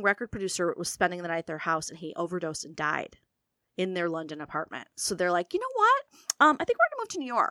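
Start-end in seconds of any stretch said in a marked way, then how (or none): 5.5-7.5 breezy and high, casual flourish; airy announcement. Retold or imitated. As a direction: toss it off like a grand whim. Mother announcing the move.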